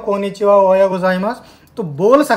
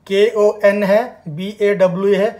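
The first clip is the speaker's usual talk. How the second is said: normal talking voice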